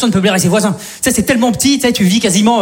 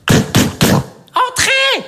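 high squeaky entrez; 13 semitones higher than usual. Pretend. Door sound gag, voice shifts.

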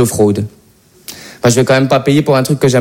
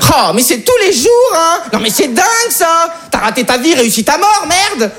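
high, shouted exasperated rant; irate Parisian commuter; 11 semitones higher than usual. Acted. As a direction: rail at the delay like a fed-up commuter. (Parisien excédé qui râle, voix aiguë)

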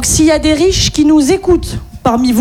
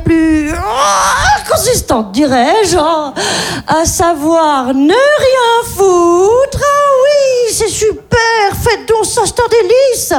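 put-on posh rich-lady accent; 6 semitones higher than usual. A rich woman boasts to the audience in a posh accent. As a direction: gush in a rich woman's accent about doing nothing. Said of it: "parfaite maîtrise de l'accent de riche".

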